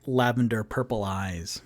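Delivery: normal talking voice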